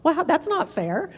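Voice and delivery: lightly voiced husband, laughing, then rising high in protest; a hint of the put-upon husband; husband protesting it's not fair; husband's protest. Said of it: "And he said".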